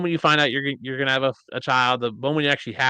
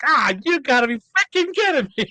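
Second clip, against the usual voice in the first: exasperated disbelief; 14 semitones higher, 5 dB louder than usual. Retold or imitated. Reacting to news he's getting a cat.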